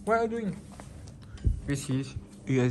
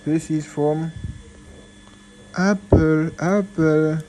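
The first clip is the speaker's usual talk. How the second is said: excited, loud; 7 dB louder, slower than usual. Real, not acted. Excited about the mouse.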